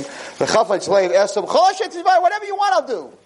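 put-on high, eager young son's voice. Son promising his father anything, high and eager.

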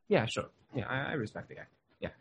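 a wife's lukewarm, quiet yeah sure; dismissive yes. Retold or imitated. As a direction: toss it off like it barely matters. Average wife answering about respect.

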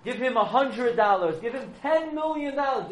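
high, loud, frantic pleading from the dead husband; desperate yelling. Acted. Husband in wife's dream, yelling at her to get his share back. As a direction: shout it like a tormented soul begging. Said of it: "He's yelling".

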